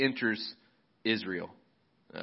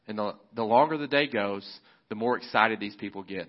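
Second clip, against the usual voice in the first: ordinary talk, nothing marked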